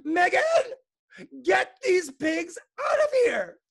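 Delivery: very high, loud, drawn-out screech; principal's yell. A narrator's reading. Principal orders Megan to remove pigs, high screeching yell. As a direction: screech the order at the top of your voice. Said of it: "The principal yelled".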